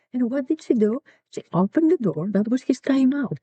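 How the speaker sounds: ordinary delivery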